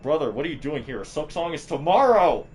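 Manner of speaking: high, loud excited outburst; excited yelling. Retold or imitated. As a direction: yell it in disbelief at a friend. Retold excited outburst at viewer.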